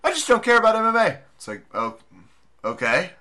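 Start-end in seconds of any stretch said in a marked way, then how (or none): 0.1-1.2 mock friend voice. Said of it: "friends telling us".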